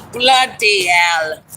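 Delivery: mock British. Exclaims over dripping sweat.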